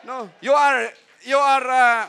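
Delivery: ordinary delivery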